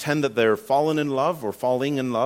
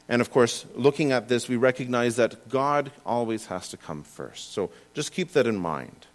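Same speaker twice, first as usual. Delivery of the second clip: ordinary delivery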